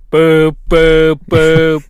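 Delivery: loud flat beeping boops; loud boop boop of a hung-up line; busy tone imitation. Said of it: "So the phone's just like".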